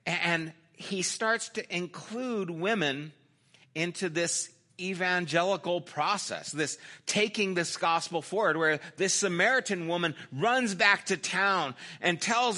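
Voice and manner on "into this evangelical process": normal talking voice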